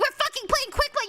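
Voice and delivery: very high mock yell; retold furious comeback. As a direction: burst out in exaggerated outrage. Retold argument, her angry comeback.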